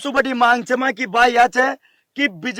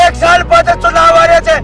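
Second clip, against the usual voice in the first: high, loud warning shouted at a politician; angry warning; 4 semitones higher, 5 dB louder than usual. Real, not acted. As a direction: shout it as a public threat. (ऊँची आवाज़ में गुस्से भरी चेतावनी)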